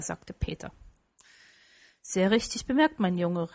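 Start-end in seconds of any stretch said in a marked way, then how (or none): none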